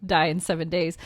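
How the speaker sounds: normal talking voice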